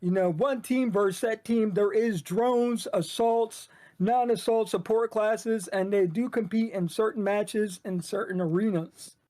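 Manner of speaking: shoutcasting voice. Shown as a demonstration. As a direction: announce the game to a match audience. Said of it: "in your shoutcasting voice".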